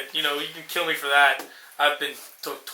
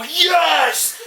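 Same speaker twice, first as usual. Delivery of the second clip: mock triumphant yell; 9 semitones higher than usual. Boxer landing the knockout, triumphant yell. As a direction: punch the word out with the fist twist. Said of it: "he'll go".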